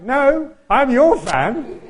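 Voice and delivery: loud, high, drawn-out protest; emphatic counter-call. Retold or imitated. Tuba player to his jazz hero, loud high protest. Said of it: "And I'm like".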